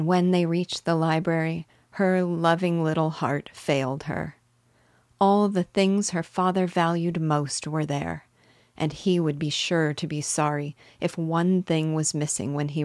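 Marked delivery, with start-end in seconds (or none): none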